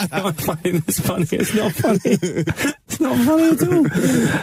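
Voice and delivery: giggling high through the words; laughing. Real, not acted. Laughing at his own Mister Flood joke, giggling high.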